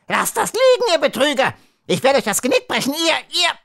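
very high, frantic screeched threat; furious screech. Acted. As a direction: screech at the thieves in helpless fury. Bakaliko jumps up, fists raised.

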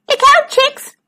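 high-pitched storybook shout; shouted high, in character. A narrator's reading. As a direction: call out a high warning to the chicks. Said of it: "he shouted".

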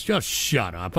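low, quiet, fed-up dismissal; weary irritation. Real, not acted. Streamer dismissing a chatter's joke, weary low mutter.

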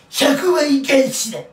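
slow, loud mock catchphrase; failed Hanzawa impression. Vlogger attempts a TV drama impression, loud and slow. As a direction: ham up a TV hero's line, badly. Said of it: "全然ね、モノマネができてない".

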